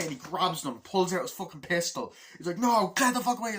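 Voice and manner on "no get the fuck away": high, loud warning shout; mock furious yell; acted angry order. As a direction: imitate a soldier driving people back. Acting armed standoff in film.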